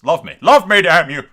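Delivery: a hammed-up demand for love; mock desperate shout. Player to Tywin in the game, loud mock shout.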